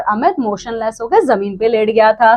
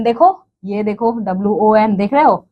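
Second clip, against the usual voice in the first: normal talking voice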